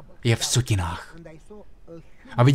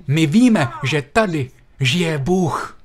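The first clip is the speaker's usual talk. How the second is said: awed, slow declaration; 4 semitones higher, much slower than usual. Retold or imitated. Preacher re-voicing neighbour with awe.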